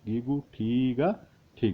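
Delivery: slow repeat of the spelling. Shown as a demonstration. Teacher repeating the spelling slowly for students. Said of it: "same".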